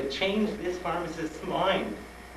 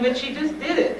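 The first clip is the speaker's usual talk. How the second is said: high, loud mock complaint; hammed-up indignant objection; exasperated protest; 13 semitones higher, 5 dB louder than usual. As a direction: object in pleading disbelief. Retelling protest to the doctor.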